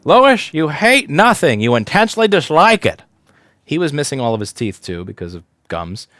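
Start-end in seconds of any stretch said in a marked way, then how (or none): 0.0-3.0 slow, loud, higher mock boss voice; old boss quoting. Old boss scolding the young Loish, loud and slow. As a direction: boom it out like an old mentor. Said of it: "My first real boss ... said".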